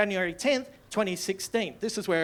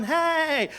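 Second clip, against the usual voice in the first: greeting hey; 6 semitones higher than usual. Retold or imitated. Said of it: "He's gone".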